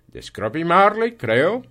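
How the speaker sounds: high, loud, rising polite query; inquiring gentleman. Acted. Visiting gentleman checks the firm's name, high and loud. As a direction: lift the voice in a formal enquiry.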